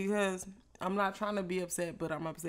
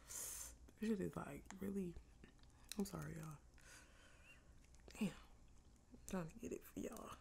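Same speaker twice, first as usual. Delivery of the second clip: real hushed whisper; straining to finish while staying silent; 7 dB quieter than usual. Real, not acted. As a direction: keep it under your breath, barely voiced. Trying to be quiet on camera.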